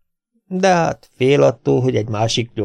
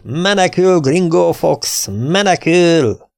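much higher, louder and slow, gloating cry; triumphant shout; 7 semitones higher, 3 dB louder, much slower than usual. Acted. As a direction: crow over the fleeing bandit, drawn out. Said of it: "diadalmas kiáltást hallatott". (Tom diadalmasan, lassan kiáltja a menekülő banditának)

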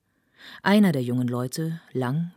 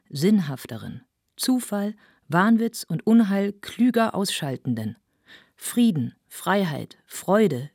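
raised, emphatic declamation; fervent orator; 5 semitones higher, 5 dB louder than usual. Acted. Speaker proclaims a changed world to the assembly, fervent oratory. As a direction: punch out each item of the list. Orator's speech read in character.

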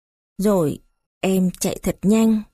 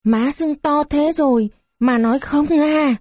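narrated startled outcry; exclaiming; 7 semitones higher than usual. A narrator's reading. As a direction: exclaim in disbelief, pitch lifted throughout. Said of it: "rồi kêu".

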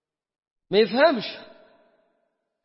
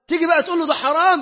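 louder and higher, pressing the point; raised voice; 9 semitones higher, 7 dB louder than usual. Retold or imitated. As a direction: call it out like a warning. واعظ يحكي مواجهة، صوت مرتفع. (بصوت عالٍ ومرتفع)

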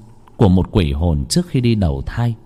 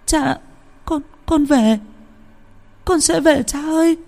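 put-on much higher child's voice, sobbing and broken; bursting into tears. A narrator's reading. Little girl sobs to her father from the afterlife, high and tearful. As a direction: cry out to your father through heavy tears. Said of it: "Cô bé òa khóc đến thảm thiết".

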